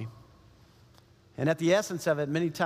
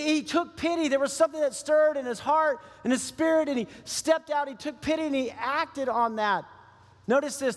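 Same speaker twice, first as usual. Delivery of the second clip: full-force preaching; 6 semitones higher than usual. Acted. Pastor urging the congregation to act, impassioned preaching. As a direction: drive it home like a revival preacher.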